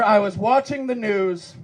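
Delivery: usual speaking voice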